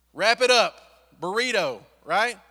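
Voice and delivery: bursting out loud and high; excited exclaim. Real, not acted. Preacher to congregation, loud excited burst. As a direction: punch it out like a gleeful aside. Animated point about wrapping babies.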